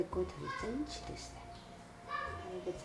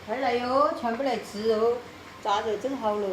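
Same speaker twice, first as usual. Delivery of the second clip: loud high call to the table; 5 semitones higher, 9 dB louder, slower than usual. Real, not acted. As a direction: call everyone in from the next room. Calling family to the table.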